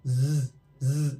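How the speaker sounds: two long low zzz buzzes; vibrating z sound. Shown as a demonstration. Teacher letting learners feel the vibration, z buzz. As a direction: hum a sustained z twice. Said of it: "this vibration".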